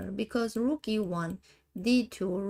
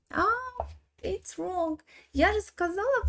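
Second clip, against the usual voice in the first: surprised; 11 semitones higher than usual. Real, not acted. Spots mistake mid-analysis.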